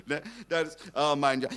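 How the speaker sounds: usual speaking voice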